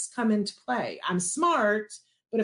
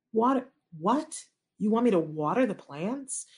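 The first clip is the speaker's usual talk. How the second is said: retold mild incredulity; baffled disbelief. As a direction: echo the instruction as if it makes no sense. Mother baffled by the odd instruction.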